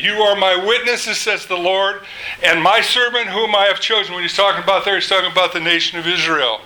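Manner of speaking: ordinary delivery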